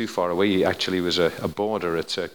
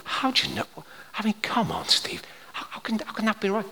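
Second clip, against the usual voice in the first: high, hushed disbelief; incredulous doubter; 12 semitones higher, 5 dB quieter than usual. Acted. Doubter challenging preacher Steve's claim, incredulous. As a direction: question it in a high, half-whispered voice.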